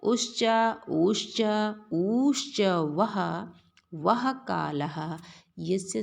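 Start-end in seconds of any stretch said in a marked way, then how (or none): none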